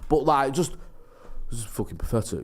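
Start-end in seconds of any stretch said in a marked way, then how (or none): 1.5-2.4 quiet fuming. Retold or imitated. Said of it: "Really, really articulating anger".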